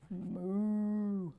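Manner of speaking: a drawn-out cow moo; playful cow lowing; mock cow moo. Cow sound in book reading.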